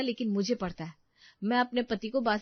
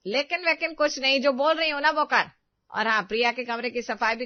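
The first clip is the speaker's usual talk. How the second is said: loud, higher stern mother-in-law; angry scolding; 5 semitones higher, 6 dB louder than usual. Acted. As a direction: cut her off and command. Mother-in-law cuts off protest. (सास की गुस्से भरी डाँट)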